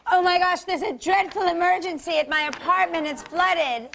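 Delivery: put-on panicky excuse, mildly hammed-up; pretend emergency. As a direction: fake a dreadful crisis to escape a date. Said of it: "And you would either say".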